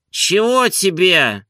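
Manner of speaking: high, slow, irritated question; mildly menacing master's voice. A narrator's reading. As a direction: challenge the one who woke you. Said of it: "грозно спросил Обломов".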